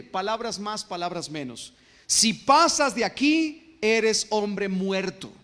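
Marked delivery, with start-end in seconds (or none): 2.1-5.3 loud, slow warning from a wall sign; advertencia solemne. Acted. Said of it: "grandes carteles en diferentes idiomas que decían". (advertencia solemne, fuerte y lenta)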